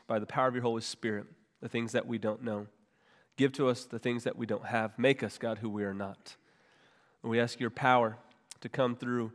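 soft, hushed pastoral prayer. Real, not acted. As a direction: pray quietly and humbly over the church. Leads the congregation in prayer.

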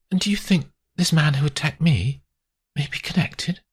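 high, questioning woman's voice; worried victim. Acted. Grace asking about her attacker, high and worried. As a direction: ask it in a high, uneasy voice.

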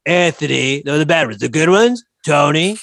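boastful rambling Trump impression. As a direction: ramble like Trump, full of himself. S0 holds Trump voice.

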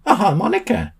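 high, delighted find; exclaiming. A narrator's reading. Sam shows Ollie what he found, excited. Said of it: "exclaimed Sam".

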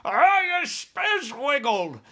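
high, loud, slow giant yell. Acted. Tied-up giant yells at the helicopters, loud and high. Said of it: "yelled the child chewer".

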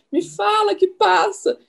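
high, slow imitation of a begging patient; host lightly mimicking the patient's plea; mock plea. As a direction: play back her plea to the doctor, stretched and high. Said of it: "você perguntou pro médico".

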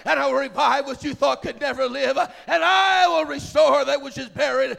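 loud, thundering preaching with stretched key words; shouted prophetic oracle; prophetic declaration. Real, not acted. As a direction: proclaim it as God's own word at full force. Declaims prophecy as God speaking.

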